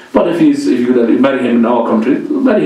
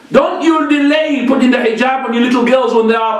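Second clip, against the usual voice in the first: usual speaking voice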